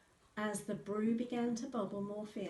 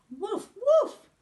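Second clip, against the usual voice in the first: very high, loud yapping woofs; dog barks; 16 dB louder than usual. A narrator's reading. Girl under the spell barks at the wizard, yapping. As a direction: bark twice like a small dog.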